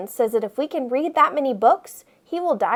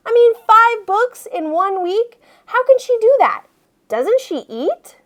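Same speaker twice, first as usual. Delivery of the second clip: disbelief; 9 semitones higher than usual. Acted. Kid narrator disbelieving.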